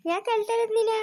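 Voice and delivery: high, playful cooing. Real, not acted. Cooing at a crying baby. (ಅಳುವ ಮಗುವಿಗೆ ಎತ್ತರದ ಮುದ್ದಿನ ದನಿ)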